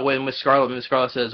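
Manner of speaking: ordinary delivery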